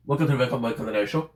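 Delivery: talking through a mouthful; sticky mouthful of peanut butter; mouth full of peanut butter. Real, not acted. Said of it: "my mouth is full of".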